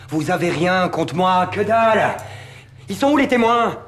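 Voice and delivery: loud, rising, heated challenge; defiant protest. Acted. Suspect telling police they have nothing, loud defiance.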